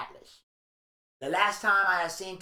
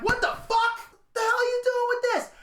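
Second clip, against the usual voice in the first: much higher, outraged what-the-fuck; shocked disbelief. Retold or imitated. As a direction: squeal it out in stunned disbelief. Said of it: "I'm like".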